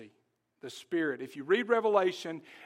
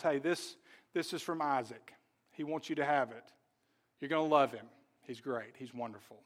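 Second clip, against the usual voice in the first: soft, low consoling voice, trailing quieter; gentle reassurance; 4 semitones lower, 5 dB quieter than usual. Acted. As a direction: comfort a homesick bride softly. Servant comforts a sad bride.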